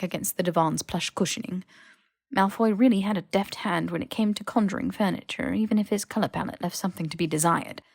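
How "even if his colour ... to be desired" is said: usual speaking voice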